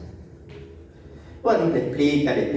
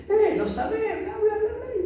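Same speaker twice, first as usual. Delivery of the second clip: mock disciples. Disciples' chatter mocked.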